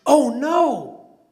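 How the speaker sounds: sudden high panicked cry, half-acted; mock shriek. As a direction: yelp it out like he just lost everything. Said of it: "he shrieks".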